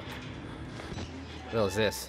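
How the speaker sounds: slow, unvoiced whisper. Real, not acted. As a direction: breathe it out slowly, scared. Creeping in dark area.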